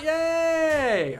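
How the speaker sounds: high, loud little cheer of relief; excited yay. Real, not acted. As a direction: celebrate the lucky reveal. Card is not a taunt.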